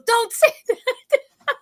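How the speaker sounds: mock the scared cousin while cracking up; laughing plea. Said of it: "And then she goes".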